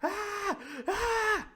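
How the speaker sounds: high, drawn-out shrieks; hammed-up distant screaming; mock scream. Shown as a demonstration. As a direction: let out two high imitation screams. Re-enacts the scream while miming.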